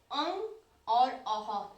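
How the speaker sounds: अंग और अह said slowly, each sound set apart; slow articulated demo. Shown as a demonstration. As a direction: spell out अंग और अह slowly for students. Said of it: "हम आगत स्वर बोलते हैं".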